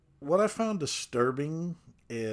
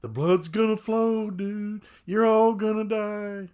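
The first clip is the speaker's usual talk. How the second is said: crazy doomsayer voice; 8 semitones higher, 5 dB louder than usual. Retold or imitated. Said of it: "Duffy's still sitting".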